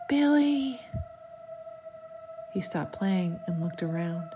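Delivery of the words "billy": high, drawn-out ghostly call; a voice calling from nowhere; whispered call. A narrator's reading. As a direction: call the name like a spirit luring a child. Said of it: "heard a faint whisper".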